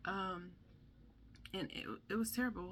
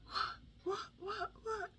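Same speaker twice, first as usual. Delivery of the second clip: put-on much higher, breathy panicked child repeating what; panicked little boy; slower than usual. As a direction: imitate a startled kid who doesn't understand. Son confused waking from sedation.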